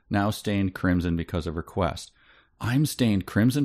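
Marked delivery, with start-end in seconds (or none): none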